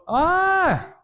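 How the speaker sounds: long, very high held "ah"; high acknowledging call. Retold or imitated. Villagers answering from across the valley, high long call. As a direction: call back an understanding "aah". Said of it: "And they were".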